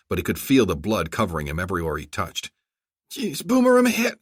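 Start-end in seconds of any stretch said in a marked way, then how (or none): 3.1-4.2 very high, strained voice; pained cry. A narrator's reading. Wounded commander to Boomer under gunfire, high pained cry. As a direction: cry out hurt, pitched way up. Wounded man cries out to co-pilot.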